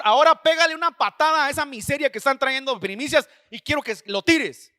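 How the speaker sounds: retold divine order, loud and scornful; forceful order. The Lord orders him to kick away the offerings, forceful order. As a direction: raise the voice, hammering out the command.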